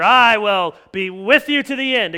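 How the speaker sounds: loud, high, mock-heroic pledge; hammed-up bragging disciple; boastful vow. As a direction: proclaim it grandly, far too confident. Mocking Peter's bold boast.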